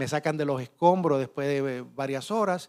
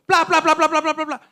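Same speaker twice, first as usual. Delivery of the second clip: high, loud popping imitation; imitated bursting lights; 11 semitones higher, 6 dB louder than usual. Survivor mimicking fluorescent lights bursting in unison, high popping sounds. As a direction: pop each pla like a tube shattering.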